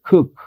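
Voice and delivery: "Cook" with a short relaxed U; teacher's model word. Shown as a demonstration. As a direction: say "Cook" clearly, short vowel. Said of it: "¿Cómo debería pronunciar".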